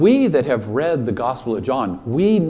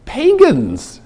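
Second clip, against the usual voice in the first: anxious disciple voice; 10 semitones higher than usual. Retold or imitated. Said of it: "想像得到佢哋話係".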